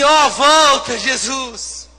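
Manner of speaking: high, loud, whining mock lament; mock whiny crying out. Pastor mocking believers begging Jesus to come back, loud whiny wail. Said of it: "ficamos assim".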